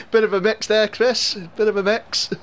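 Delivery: high, laughing tease; amused teasing. Real, not acted. Streamer ribs a viewer on his mixed teams, amused teasing.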